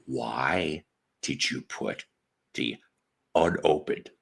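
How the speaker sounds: low, deliberate interrogation. Retold or imitated. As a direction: space out each word in controlled disbelief. Exasperated retold questioning of coworker.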